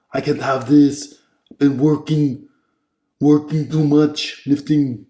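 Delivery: hammed-up shouting tough guy in a foreign accent; Arnold Schwarzenegger impression. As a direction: bark orders like a muscle-bound movie commando. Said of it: "let's do the Arnold impression".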